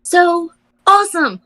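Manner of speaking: high excited cheer. Real, not acted. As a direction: burst out so awesome. Gushing exclamation.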